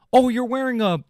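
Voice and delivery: high whiny mock-punk sneer; high snotty impression of rule-bound punks.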